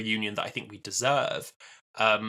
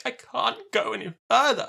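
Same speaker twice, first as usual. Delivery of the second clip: mock helpless damsel, pitched higher; whiny helpless quote; 10 semitones higher than usual. Sarah Jane stuck in the tunnel, mock helpless whine. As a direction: whimper it like a stranded damsel.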